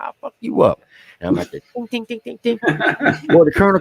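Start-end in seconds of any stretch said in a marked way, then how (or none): 0.0-0.8 mock threat. Threatening laughing privates. 1.5-2.6 hammer tapping sound. Retold or imitated. Imitating hammer on armor.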